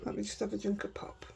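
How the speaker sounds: whispered aside; whispering. Real, not acted. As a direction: whisper it to yourself. Aside while taking a drink.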